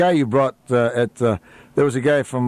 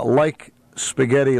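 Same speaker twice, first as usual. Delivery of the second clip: normal talking voice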